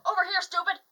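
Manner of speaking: high, loud cheeky car voice; car alarm voice. Retold or imitated. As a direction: bark it like a rude car. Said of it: "wants my grandmother's car to go".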